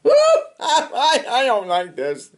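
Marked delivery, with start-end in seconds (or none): none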